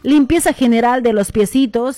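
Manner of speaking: loud ad read. Acted. Radio host hammering a sponsor ad to listeners. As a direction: sell it like a radio spot.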